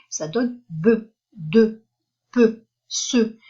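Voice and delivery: be, de, peu, ce each clearly voiced with a short e; be de peu ce said one by one; sounding out syllables. Shown as a demonstration. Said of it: "Le son E".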